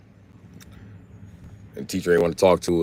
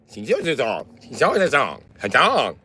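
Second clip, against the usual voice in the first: caricatured foreign syllables with swooping tones; 4 semitones higher than usual. Retold or imitated. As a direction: imitate a foreign language in gibberish. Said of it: "Them bitches is all TikTok like".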